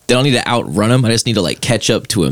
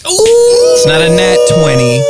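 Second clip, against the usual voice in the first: higher, louder and slower, drawn out; excited dice call; 6 dB louder, slower than usual. Real, not acted. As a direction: savour the roll with glee. Excited over the dice roll.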